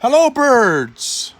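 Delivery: high, loud, slow drawn-out hello; joyful greeting. A narrator's reading. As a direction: welcome the birds with delight. God greets creation joyfully.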